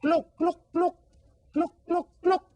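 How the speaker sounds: high clucks of a hen; high hen clucking. Shown as a demonstration. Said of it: "What sound does the gallina make".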